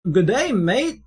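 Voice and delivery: a playful fake Australian greeting; lightly faked Australian accent; mock Australian accent. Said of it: "It's me, an Australian".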